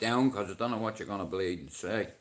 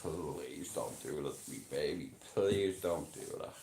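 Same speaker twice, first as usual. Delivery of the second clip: low, hushed mock begging; pleading impression; 6 semitones lower, 6 dB quieter than usual. Streamer imitating someone begging a baby to stop, low pleading impression. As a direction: drop low and soft to plead, putting it on. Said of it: "this is an impression of someone".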